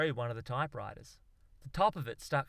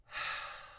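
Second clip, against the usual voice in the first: long, deep breathy sigh; 8 dB quieter than usual. Acted. Australian Charles Darwin sinks into his chair, long sigh. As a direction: let all the air out slowly. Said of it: "let out a long, deep sigh".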